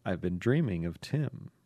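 low, soft and dreamy; sexy dream voice. Acted. Letter writer confessing dreams, low sexy murmur. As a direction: drop into a hushed, sultry dream voice. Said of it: "drop into your sexy dream voice".

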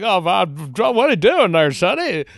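put-on high, loud grizzled old-timer voice; old prospector voice. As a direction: hoot it out like an old Western prospector. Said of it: "Gordon Gosselaar plays him like".

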